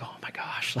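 whispered, awed aside; hushed amazement. Retold or imitated. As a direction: breathe it out very softly, as if secretly smitten. Retelling his amazed reaction to her.

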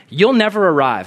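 usual speaking voice

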